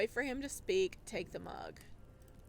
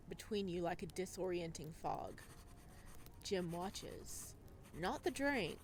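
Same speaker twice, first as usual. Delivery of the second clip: calm, measured storytelling voice; story narration; 3 semitones lower, 5 dB quieter than usual. A narrator's reading. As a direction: read it like an audiobook narrator.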